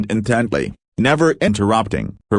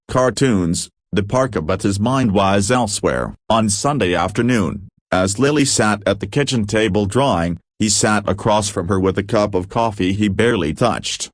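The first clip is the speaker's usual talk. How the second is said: ordinary delivery, nothing marked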